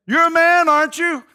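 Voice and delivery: loud high taunting shout; mocking call. David mocking Abner from the hilltop, loud taunt. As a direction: jeer at him from far off.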